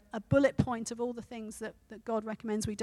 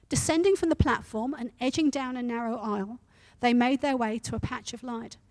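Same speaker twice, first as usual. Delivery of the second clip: a preacher reading a story to his church; 5 dB louder than usual. A narrator's reading. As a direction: tell the story with steady energy. Reads story aloud to congregation.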